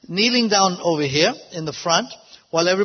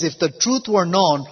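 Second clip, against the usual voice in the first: ordinary talk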